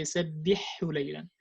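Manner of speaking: سَبِّحْهُ لَيْلًا fast, ha swallowed; rushed wrong. Shown as a demonstration. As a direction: gabble it carelessly. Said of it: "it's very easy to say".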